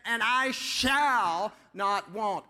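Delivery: SHALL held long and strong; slow declaration, weight on "shall"; stress on SHALL. Shown as a demonstration. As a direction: lean into "shall" as a firm statement. Making a statement, stressed shall again.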